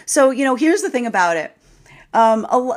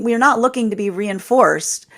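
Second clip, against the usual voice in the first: ordinary talk, nothing marked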